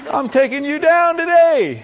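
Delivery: high, loud, drawn-out challenge; battle-cry taunt. Acted. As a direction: holler it slowly at the giant. David taunting the giant, climax.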